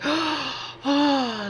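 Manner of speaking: high, breathy "Oh" of wonder; a gentle imitation of stunned awe on coming back; retold awed gasp. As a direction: let out a soft amazed "Oh". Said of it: "and I was like".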